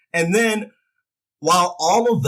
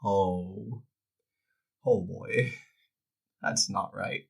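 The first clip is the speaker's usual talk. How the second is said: quiet dismay; 6 semitones lower, 10 dB quieter, slower than usual. Retold or imitated. Dismayed reaction to the promo.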